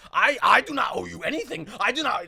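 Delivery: imitated indignant kid, high and loud; crazy kid's voice. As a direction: refuse in a put-on agitated oddball voice. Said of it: "I swear this is his voice".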